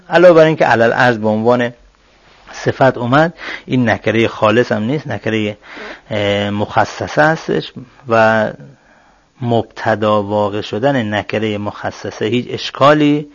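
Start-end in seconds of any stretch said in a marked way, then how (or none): none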